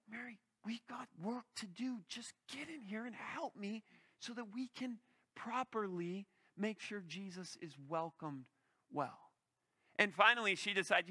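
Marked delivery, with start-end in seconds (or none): 0.1-9.3 soft, hushed scolding whisper; whispered nagging. Acted. Martha whispering to Mary to come help, softer scolding. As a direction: hiss the orders under your breath. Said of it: "Should I whisper to Mary?".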